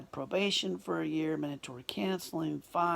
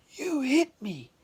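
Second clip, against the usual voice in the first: high, loud mock outrage; put-on indignant cry; indignant accuser; 9 semitones higher, 7 dB louder than usual. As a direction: imitate an aggrieved man accusing you. Man's accusation acted out.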